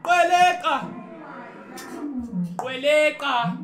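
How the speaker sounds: high, loud made-up call, drawn out; calling the lion. Acted. To Linda, summoning his pet lion with a loud call. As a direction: summon an imaginary lion from far away. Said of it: "Should I call it?".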